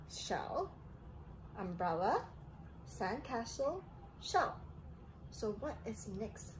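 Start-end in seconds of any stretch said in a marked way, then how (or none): none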